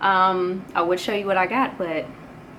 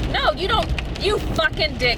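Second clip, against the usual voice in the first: furious at a driver; 9 semitones higher than usual. Retold or imitated. Cursing at a driver who cut in.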